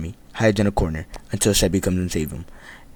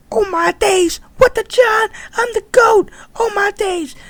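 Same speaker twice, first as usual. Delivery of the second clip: squeaky high mock celebration, partly louder; hyped squeal; 4 dB louder than usual. Retelling his own hype after nearly dying, in a squeaky high squeal. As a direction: squeal like you just pulled off a miracle. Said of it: "I was like".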